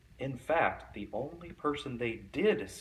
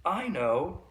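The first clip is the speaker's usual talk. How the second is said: higher, louder eager exclaim; know-it-all exclaim; 7 semitones higher, 4 dB louder than usual. A narrator's reading. Know-it-all kid guessing about Miss Nelson, eager exclaim. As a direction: blurt it like the smartest kid. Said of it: "exclaimed one know-it-all".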